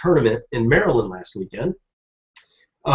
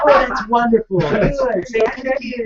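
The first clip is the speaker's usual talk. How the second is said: high, bright mock-polite chirp; mock cheerful; 9 semitones higher than usual. Co-host parodying the mom's clueless reply, mock cheerful chirp. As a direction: ham up the clueless niceness.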